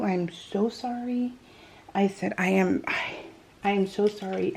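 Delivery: soft, hushed retold apology; quietly remorseful; contrite apology. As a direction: imitate yourself apologizing sheepishly. Mom's apology to daughter, retold.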